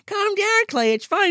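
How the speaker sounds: high, mock-sweet mother voice; hammed-up high reassuring mother; soothing mom. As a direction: play a falsely calm mom. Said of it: "She's like".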